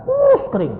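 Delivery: very high-pitched, comic emphasis; exaggerated squeal. Preacher repeats how skinny he was, high squeal. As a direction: squeak it out, hammed-up.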